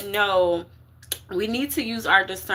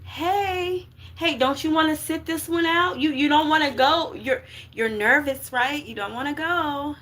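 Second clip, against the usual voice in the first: much higher, soothing, persuasive voice; coaxing fear; 7 semitones higher than usual. Acted. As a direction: talk them out of going, sweetly. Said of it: "That fear gonna be like".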